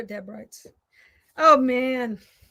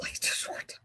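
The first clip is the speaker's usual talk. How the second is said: whisper; 10 dB quieter than usual. Real, not acted. Crafting mishap, dismayed.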